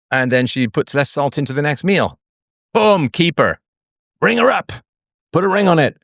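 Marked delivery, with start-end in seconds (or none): none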